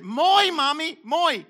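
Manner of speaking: hint of a toddler begging for moi; preacher imitating his small daughter asking mommy for moi; imitating a little girl. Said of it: "this is the way she said".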